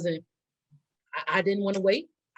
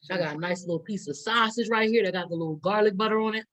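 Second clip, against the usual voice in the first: ordinary talk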